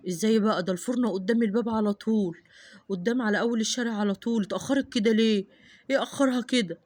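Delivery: dad wondering why she's late; worried father. Acted.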